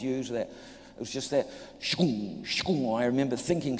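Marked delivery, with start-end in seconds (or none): 1.8-3.0 voiced shkoong buzz of a live fence; electric buzz sound. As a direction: make the zap of an electric fence with your mouth.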